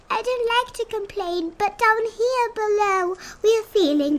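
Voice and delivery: high groaning little voice; small turtle voice. A narrator's reading. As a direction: groan it up from the bottom. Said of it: "groaned Mac".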